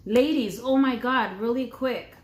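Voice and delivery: loud, bright call-out; excited call. Real, not acted. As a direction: grab their attention. Calling out to women.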